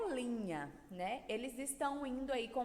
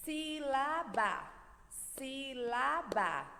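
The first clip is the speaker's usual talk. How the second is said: SÍ-LA-BA slowly, each syllable on its own beat; Sílaba broken into three even, slow beats; split into syllables; much slower than usual. Shown as a demonstration. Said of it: "Separando as sílabas, batendo palminhas".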